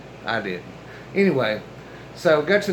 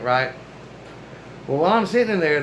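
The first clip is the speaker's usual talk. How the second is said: usual speaking voice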